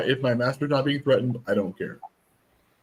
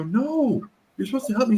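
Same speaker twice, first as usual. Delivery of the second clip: mock plea; 11 semitones higher than usual. Playing monster victim for child.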